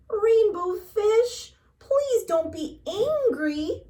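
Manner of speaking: high, slow, timid plea; pleading little fish. A narrator's reading. Little blue fish pleading with the rainbow fish, high and timid. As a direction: coax him gently, small and hopeful.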